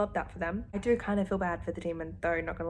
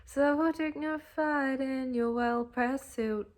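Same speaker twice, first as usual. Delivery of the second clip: slow, dreamy, higher swoon; swooning quote; 6 semitones higher, much slower than usual. Retold or imitated. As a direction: savour each word like a daydream. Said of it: "all my brain does is".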